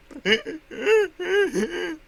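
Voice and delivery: loud, high fake crying; mock sobbing. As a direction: put on an exaggerated high-pitched sob. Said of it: "I'll stop doing that".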